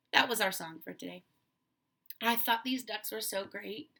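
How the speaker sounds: normal talking voice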